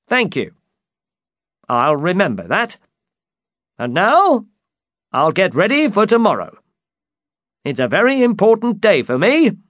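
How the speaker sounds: high, loud, eager knight; excited high-pitched storybook knight; Don Quixote. Acted. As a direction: announce it with mounting eagerness.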